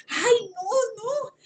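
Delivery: high, tearful, breaking ay no; real crying. Real, not acted. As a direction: let the tears catch the voice. Overwhelmed by felt hands.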